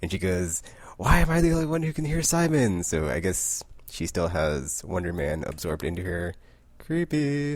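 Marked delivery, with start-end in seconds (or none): 1.0-2.8 high, creepy mock voice. Possessed Rogue with red eyes asking about Simon, creepy. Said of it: "It's really creepy". 6.8-7.5 high sing-out; pitched-up, playful eerie comment; mock spooky. Real, not acted. Reader reacting to the red-eyed close-up, mock spooky. Teasing about the creepy panel.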